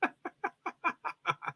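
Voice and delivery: soft, high giggling; light high-pitched laugh; giggle. Real, not acted. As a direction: titter quietly up high. Amused by her dog impression.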